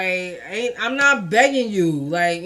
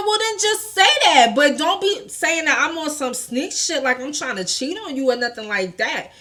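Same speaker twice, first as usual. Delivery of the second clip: indignant comeback; 5 semitones higher than usual. Retold or imitated. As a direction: re-enact arguing back at an accusation. Retold quarrel, arguing back.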